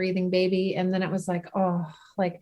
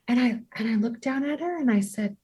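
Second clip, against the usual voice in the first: ordinary talk, nothing marked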